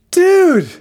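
very high, loud exasperated shout; lieutenant shouting at his officer; lieutenant yelling. Retold or imitated. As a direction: chew out the rookie cop. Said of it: "he kind of basically like".

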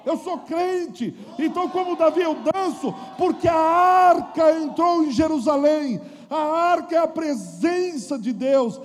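fervent shouted sermon; preaching at full force. Acted.

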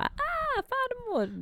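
high imitated baby voice greeting grandma. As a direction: coo it up high like the baby seeing her grandmother. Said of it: "Såg din mamma och bara".

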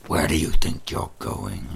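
loud, low monster roar of a question; booming monster. Acted. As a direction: stop the child cold. Monster catches fleeing child.

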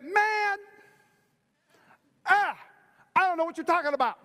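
high, loud, hammed-up protest; sputter, then blurt out the denial; exasperated denial. Peter denies Jesus a third time, loud mock exasperation.

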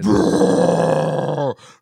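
long, loud growled roar; hardcore growl. Retold or imitated. Joking about elderly women at hardcore shows, loud growl. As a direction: imitate a hardcore vocalist's growl.

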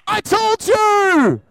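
slow, drawn-out told-you-so. Pretend. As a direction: savour it, slow and smug. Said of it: "my spirit man said".

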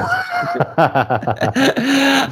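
a high, delighted laugh. Real, not acted. Host laughing at guest's reply, high pitched.

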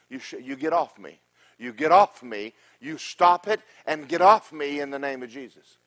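loud, forceful rebuke of Satan; shouting down the enemy in Jesus' name; commanding the devil. Retold or imitated. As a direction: order the devil off you with full authority. Preacher acts out rebuking the devil.

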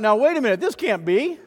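the townsfolk turning against Jesus; doubting crowd. Retold or imitated. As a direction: protest in disbelief. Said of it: "Because they thought".

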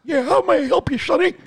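put-on a doddering old-timer calling someone sonny; old prospector. Old-timer answers as grandpa.

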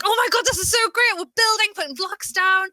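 high, hyped-up excitement; really fun and exciting. Acted. Faking excitement over a boring build, high and thrilled. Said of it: "you've gotta sound really, really fun and exciting".

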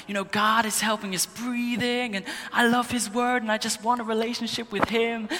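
high-pitched imitation of a passionate preacher; impassioned speaker impression, voice lifted high; Francis Chan. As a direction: mimic fervent preaching, pitched well up. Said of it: "he was so passionate for God".

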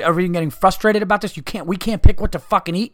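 ordinary talk

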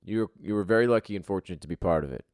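ordinary talk

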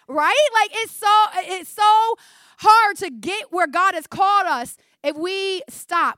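high, loud preacher's climax; preaching at full force. Acted. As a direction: drive the point home to the congregation.

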